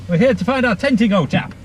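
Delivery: put-on plummy upper-class English accent; posh voice. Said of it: "so everybody put on their posh voices".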